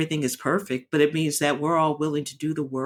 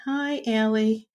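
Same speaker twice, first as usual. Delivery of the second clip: fond greeting; 6 semitones higher than usual. Real, not acted. Speaking to Allie while recording, fond and higher. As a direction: coo gently at Allie, a little amused.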